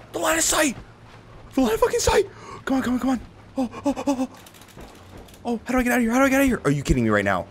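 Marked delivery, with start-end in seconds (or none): 0.1-2.2 gasp, then a much higher, raging shout; furious yell. Real, not acted. Gamer shrieking at his blocked line of sight, high furious yell. 2.6-6.6 panicked pleading. Real, not acted. Panicking trapped in the game.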